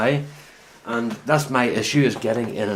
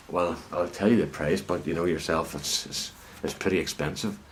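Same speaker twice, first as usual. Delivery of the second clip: a hint of a patronising murmur; mock-dismissive murmur; 6 semitones lower, 9 dB quieter than usual. Car salesman brushing off a scruffy customer, lower and softer.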